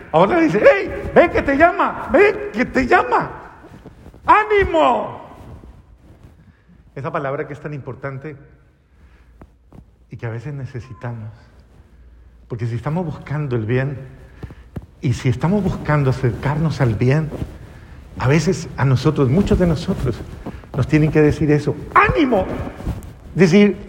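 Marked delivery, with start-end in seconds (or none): 0.6-5.2 high, drawn-out rallying cry; encouraging call. Acted. Crowd tells the blind man he is called, encouraging. 21.9-22.5 high-pitched rallying call; encouraging cry. Acted. Preacher rallies those seeking good, encouraging.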